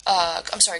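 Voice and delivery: ordinary talk, nothing marked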